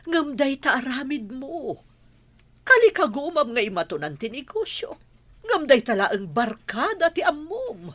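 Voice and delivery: high-pitched, worked-up rebuke; exasperated scolding. Acted. Mother scolding son about his friends, exasperated.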